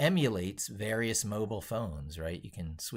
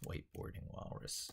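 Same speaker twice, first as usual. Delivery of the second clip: whispered to self; 11 dB quieter than usual. Real, not acted. Mutters while typing title.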